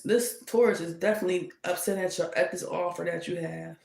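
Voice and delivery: ordinary talk, nothing marked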